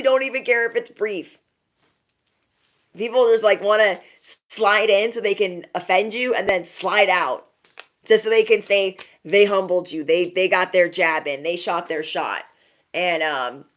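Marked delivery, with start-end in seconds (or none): none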